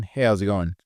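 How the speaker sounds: usual speaking voice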